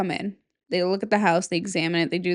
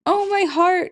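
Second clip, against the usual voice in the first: heart melting, up high; high, louder heartfelt cry; 10 semitones higher, 5 dB louder than usual. Real, not acted. Moved by ghost boy.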